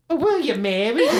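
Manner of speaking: very high, fast squeaky cartoon voice; Whitey voice. After 'I love you', proposing to his fiancée in a squeaky cartoon voice. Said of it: "like fucking Whitey from Eight Crazy Nights".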